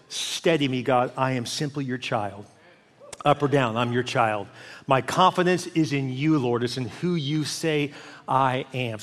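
low, softened prayer voice; humble prayer. Acted. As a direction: pray it quietly from a place of surrender. Models a prayer to God.

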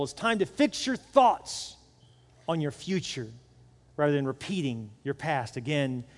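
loud preaching prayer voice; closing prayer. Acted. Pastor leads the congregation in a closing prayer, loud and earnest. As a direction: lead the church in prayer with force.

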